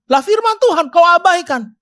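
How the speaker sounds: loud, high rebuke of the crowd; preacher scolding the congregation for ignoring God's word; rebuking preacher. Real, not acted.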